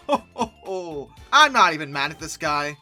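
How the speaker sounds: usual speaking voice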